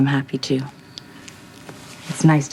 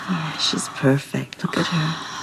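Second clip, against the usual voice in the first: slow, hushed whisper; slower than usual. Acted. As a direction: whisper so the baby stays asleep. Whispering beside sleeping baby.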